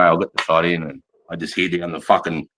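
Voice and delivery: ordinary talk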